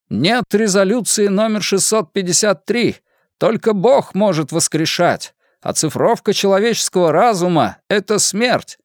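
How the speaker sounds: high-pitched street preacher ranting; rally the crowd from a crate. Acted. Orator rants to crowd.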